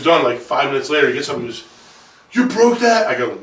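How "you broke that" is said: higher, louder retold accusation; playful outraged challenge; mock accusing dad. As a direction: imitate an indignant dad's question. Dad's accusation acted in story.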